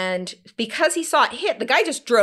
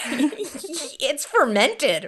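laughing; 6 semitones higher, 3 dB louder, much slower than usual. Retold or imitated. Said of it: "I'm like".

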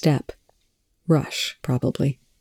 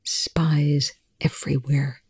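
slow, breathy whisper; hushed, drawn-out whisper; 4 dB quieter, slower than usual. A narrator's reading. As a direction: whisper it slowly. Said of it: "Telford whispered".

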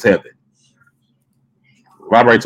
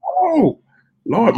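surprised; 11 semitones higher, 4 dB quieter than usual. Real, not acted. Reacting to friends' answers.

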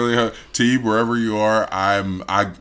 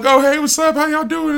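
high-pitched, overeager hello; imitated friendly greeting; 4 dB louder than usual. Adult greeting friend's kids, high imitated friendly hello.

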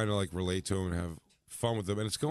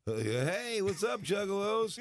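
hyped clown greeting; 9 semitones higher, slower than usual. Retold or imitated. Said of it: "He goes".

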